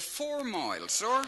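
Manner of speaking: put-on high, innocent country-boy voice; naive George. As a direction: answer like a guileless lad. George answers the officer.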